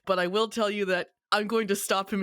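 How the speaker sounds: ordinary talk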